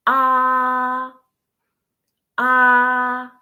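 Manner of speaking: AH held flat and straight on a steady note; first tone, flat. Shown as a demonstration. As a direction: hold AH long and level, like singing one note. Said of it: "as holding a steady note".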